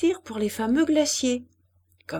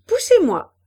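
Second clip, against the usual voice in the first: high, loud playful command; eager raised voice; calling out; 9 semitones higher, 7 dB louder than usual. A narrator's reading. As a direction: call to the children from the swing. Young woman calls to children.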